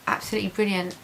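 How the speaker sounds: ordinary talk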